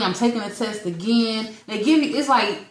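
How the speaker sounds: ordinary talk, nothing marked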